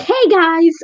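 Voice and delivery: high, loud mock greeting; hyped-up video voice. Shown as a demonstration. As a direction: chirp it like an over-energetic video intro. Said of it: "a more hyped up voice than what I normally speak like".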